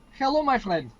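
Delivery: loud, high booming greeting; big booming voice. Acted. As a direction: boom it out like a giant. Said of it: "there's this big booming voice".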